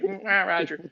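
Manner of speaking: high, hesitant put-on reply; lightly voiced embarrassed soldier; sheepish radio reply; mock sheepish Roger. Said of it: "And you're like".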